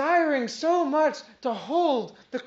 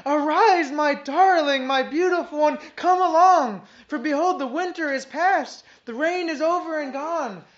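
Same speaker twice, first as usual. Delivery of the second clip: fervent preaching; 4 semitones higher, 6 dB louder than usual. Acted. Preaching Christ's call.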